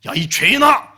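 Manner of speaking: high, loud accusing outburst; hammed-up rebuke; mock scolding shout. Neighbour condemns the envier next door, mock scolding shout.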